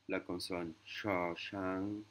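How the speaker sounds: "sha shang" said slowly on a low tone; slow low-tone demo. Shown as a demonstration. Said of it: "qui est une intonation basse".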